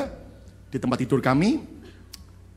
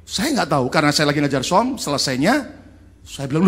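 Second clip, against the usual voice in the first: usual speaking voice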